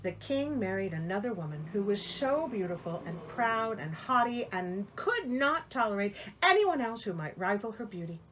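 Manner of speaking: ordinary delivery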